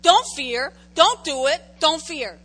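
loud, insistent repeated order; firm command. Acted. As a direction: command it again and again. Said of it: "And God says".

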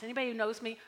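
ordinary delivery

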